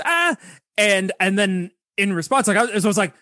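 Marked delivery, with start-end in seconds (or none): 0.1-0.4 imitated cat yowl. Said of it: "she goes".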